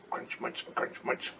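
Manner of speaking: soft unvoiced chewing words; whispered crunch munch. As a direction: whisper the munching like tiny bites. Caterpillar eating sounds.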